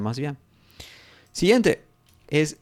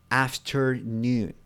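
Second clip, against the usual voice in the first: slow model word; much slower than usual. Shown as a demonstration. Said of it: "normalmente dices".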